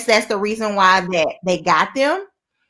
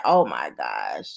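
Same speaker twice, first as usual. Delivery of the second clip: low slow unimpressed voice; 7 dB quieter, slower than usual. Retold or imitated. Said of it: "She was looking at them like".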